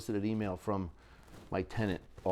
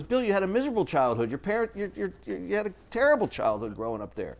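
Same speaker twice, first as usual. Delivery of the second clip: wife voice; 10 semitones higher than usual. Retold or imitated. Wife voiced in a heated argument.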